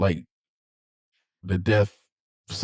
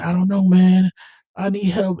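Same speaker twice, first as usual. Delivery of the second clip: panicked plea; 10 semitones higher, 4 dB louder than usual. Retold or imitated. Jesse pleading.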